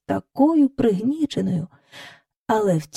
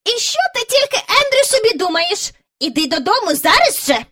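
insisting lady; 12 semitones higher than usual. A narrator's reading. Miss Lark insisting Andrew come home, high. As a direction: insist firmly. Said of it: "наполягала міс Жайворон".

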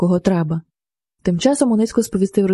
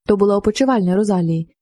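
ordinary delivery

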